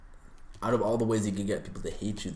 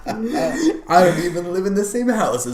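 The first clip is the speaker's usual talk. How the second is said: loud, high voice breaking up with laughter; laughing; 9 semitones higher, 10 dB louder than usual. Real, not acted. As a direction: barely hold back laughter while talking. Cracking up over girlfriend joke.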